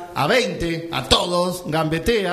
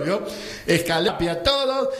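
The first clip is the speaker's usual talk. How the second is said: normal talking voice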